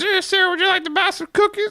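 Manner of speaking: put-on very high little-girl voice; put-on high, polite child pitch; little girl selling cookies. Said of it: "there was this girl there".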